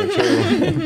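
high, giggly apology; laughing. Real, not acted. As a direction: squeak it out through laughter. Second apology, much higher, laughing.

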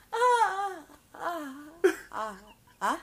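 mock laugh that fades into doubt; imitated laughter petering out; laugh dying out. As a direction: let a high fake laugh trail off. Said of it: "itu bercanda juga kan".

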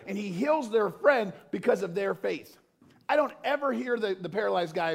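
high, loud, impassioned preaching; full-force preaching. Acted. Pastor exhorting the congregation, high and loud preaching. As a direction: preach it out, building the fire.

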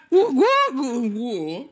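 high, breaking gu-gu sounds; mock stutter noise. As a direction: sputter it like a glitching creature. Imitating a noise in game. (imitación de sonido gu-gu, aguda)

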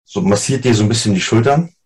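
normal talking voice

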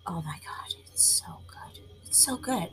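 hushed whisper of pure bliss; whispered delight. Real, not acted. Whispering to listeners about her sweet coffee. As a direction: breathe it out like a guilty pleasure.

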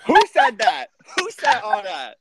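high, loud mock outrage; who said that, loudly indignant; indignant shout. Real, not acted. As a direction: shout it, offended and amused. Indignant outburst at friend.

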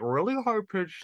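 high, hollow talking mask voice; high-pitched mask voice. Retold or imitated. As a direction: squeak it like Bane's mask voice. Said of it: "mask voice".

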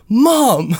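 high, delighted call to mom; acted-out eager son; excited greeting. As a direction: burst out as the door opens.

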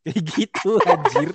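high, loud laughter breaking through the words; laughing outburst. Real, not acted. Laughing at the friend's old social media denial, amused outburst.

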